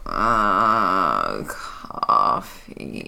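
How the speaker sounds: deep, groaning croak of a word; low unvoiced groan for coffee; groggy groan. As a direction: groan it out half-asleep. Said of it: "kind of groan and just go".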